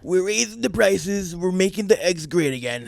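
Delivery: hammed-up Trump boasting; loud mock politician bragging; Trump impression. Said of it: "I love doing a Trump accent".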